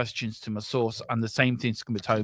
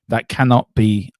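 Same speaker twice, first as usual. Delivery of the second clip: loud self-correction; 8 dB louder than usual. Real, not acted. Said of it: "said at live on air".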